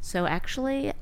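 softly composed podcaster imitation; calm podcast host. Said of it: "women who talk like".

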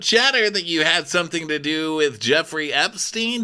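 high, emphatic interviewer voice; exaggerated talk-show host grilling; Andy. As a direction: put the question with mock gravity, pitched high. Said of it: "Andy said".

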